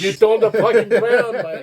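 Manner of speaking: mock Aussie soldier, shouted high; Australian accent. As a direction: yell it like a laughing Aussie soldier. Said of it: "In an Australian accent like that?".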